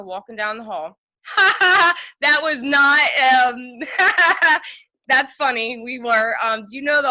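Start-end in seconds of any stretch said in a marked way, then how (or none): 1.2-2.2 sudden loud burst of laughter; real loud laugh breaking out. Real, not acted. 2.2-5.8 laughing. Real, not acted. Laughing through the memory.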